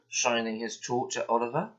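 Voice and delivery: ordinary delivery, nothing marked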